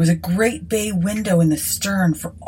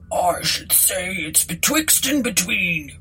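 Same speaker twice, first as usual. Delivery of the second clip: slow, pondering country voice; rustic, unhurried musing; puzzled landlord. A narrator's reading. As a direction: puzzle it over slowly as a village landlord. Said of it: "puzzling it over".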